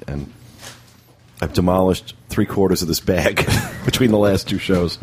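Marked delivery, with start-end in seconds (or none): none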